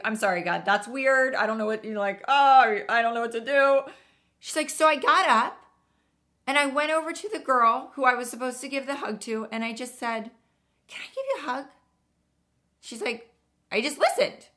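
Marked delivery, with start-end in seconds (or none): 0.0-3.8 higher, louder, comically panicked; mock flustered. Host imagines awkward reaction. 4.7-14.5 higher girlish retelling, lightly voiced; imitating the teen. Teen finishes her story.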